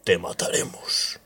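low whispered threat; menacing whisper. A narrator's reading.

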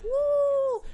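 loud high mocking whoop; sarcastic party yell; mock cheer. Grito burlón de celebración. (grito burlón, agudo y fuerte)

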